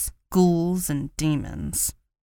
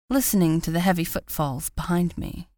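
ordinary delivery

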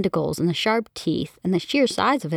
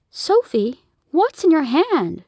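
mom's wary question; 9 semitones higher, 4 dB louder than usual. Acted. Mother alarmed at the bottle.

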